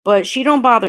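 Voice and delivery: ordinary delivery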